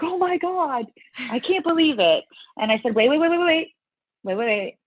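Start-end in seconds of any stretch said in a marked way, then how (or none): 0.0-2.2 high-pitched imitation of an amazed husband; mock ecstatic disbelief; excited husband. Voicing husband running out, amazed. 2.9-4.8 high, rapid-fire string of waits; re-enacted urgent interruption; urgent halting. Acting out halting the excited husband.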